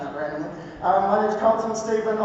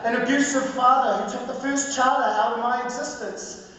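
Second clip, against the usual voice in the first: higher-pitched, measured poetry recital with weighted pauses; spoken word poetry; 6 semitones higher than usual. Acted. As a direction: deliver it as a rhythmic spoken word testimony. Performs spoken word poem.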